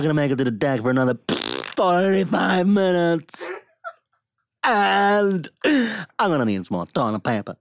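halting, hammy distress with long pauses; Captain Kirk impression. Captain stuck in the toilet calling Scotty, strained Kirk impression. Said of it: "This is Captain Kirk stuck in the toilet".

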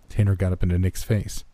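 ordinary delivery

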